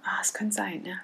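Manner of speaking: whispering. Real, not acted. Unsure aside after Kassel remark.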